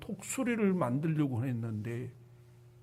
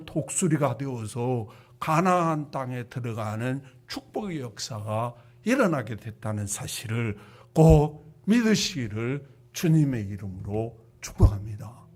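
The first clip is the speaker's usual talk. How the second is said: ordinary delivery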